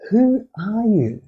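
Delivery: slow, high-pitched, deeply curious question; greater curiosity. Shown as a demonstration. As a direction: draw it out high, really wanting to know. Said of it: "ask them with greater curiosity".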